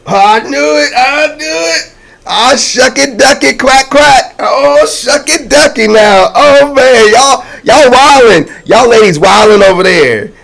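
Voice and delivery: high, loud, hollering excitement; playful over-the-top outburst; hyped-up shock. Real, not acted. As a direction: holler it high, scandalized by what you see. Hyped mock shock at the cards.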